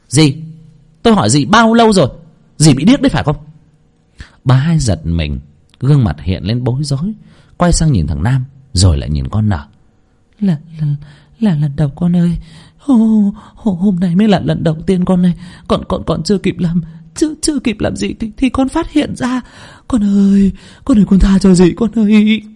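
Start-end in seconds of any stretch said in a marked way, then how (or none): 0.1-3.4 higher, fast angry questioning; hissing demand. Acted. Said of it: "rít lên". 10.4-22.4 much higher, stammering and pleading; stammering excuses. Acted. Aunt stammering excuses, begging.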